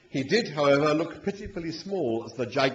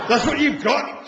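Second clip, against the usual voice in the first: in awe; 12 semitones higher, 7 dB louder than usual. A narrator's reading. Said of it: "said the machine in considerable awe".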